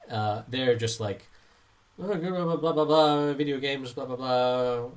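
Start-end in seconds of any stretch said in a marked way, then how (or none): 2.0-4.9 lightly mocked grumbling parent; complaining parents. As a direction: mimic parents droning out complaints in blahs. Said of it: "I also don't complain like this".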